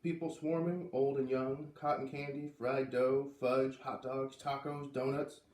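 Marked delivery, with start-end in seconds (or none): none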